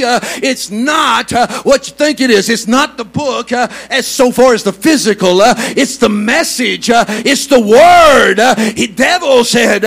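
loud, high, driving sermon with rhythmic uh's; fiery Pentecostal preaching; preaching at full force. Acted. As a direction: preach it hard to the congregation.

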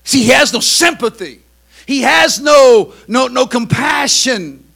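loud, slow preaching build; preaching shout. Acted. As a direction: bear down on no compassion.

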